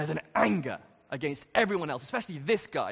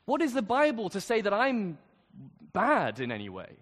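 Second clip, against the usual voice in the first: indignant protest; 5 semitones higher than usual. Retold or imitated. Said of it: "react against and hate and think".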